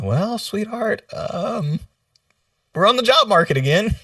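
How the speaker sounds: joking, high-pitched reluctant announcement; sheepish mock confession. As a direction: break bad news in a comically high voice. Acting out breaking news at home.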